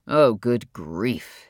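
low, very slow weary sigh of words; exasperated. Acted. Father reacting wearily to the situation, exasperated. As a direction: drag it out, fed up.

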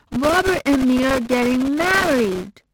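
put-on high, loud, slow childlike insistence; Phoebe's voice. A narrator's reading. Phoebe arguing with her mom, high and slow. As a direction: plead your case like a stubborn child.

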